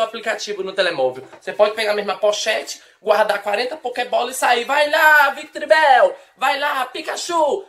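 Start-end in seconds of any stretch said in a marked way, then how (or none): 4.7-7.6 louder, higher battle cry; Pokémon trainer call. Pretend. Mocking a trainer sending out Pokémon, shouted.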